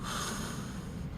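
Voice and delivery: long slow breath out; slow releasing sigh; audible exhale. Shown as a demonstration. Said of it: "Everybody take a breath".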